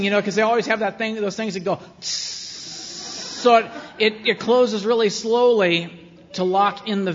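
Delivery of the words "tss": long imitated hiss of a closing door; slow pneumatic door-closer hiss. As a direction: draw out a soft, voiceless "tsss". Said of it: "is, is hissing".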